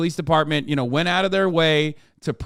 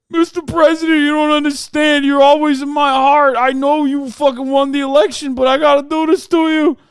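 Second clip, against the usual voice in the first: hammed-up wailing New York cop, high and shouted; New York cop accent; 11 semitones higher, 8 dB louder than usual. Host as crying cop apologizing to Trump, shouted New York accent. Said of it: "wouldn't have that kind of accent".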